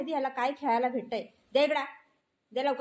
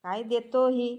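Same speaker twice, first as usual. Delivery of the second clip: ordinary talk, nothing marked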